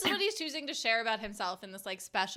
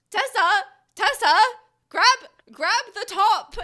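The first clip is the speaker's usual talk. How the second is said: mock frantic plea; 10 semitones higher, 13 dB louder than usual. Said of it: "he was like".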